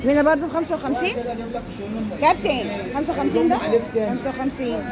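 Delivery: ordinary talk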